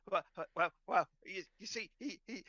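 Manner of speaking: high, loud, stammering bliss; breaking into giddy begging; overcome scratched dog. Acted. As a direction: try to talk while melting from scratches. Dog tries answering while scratched.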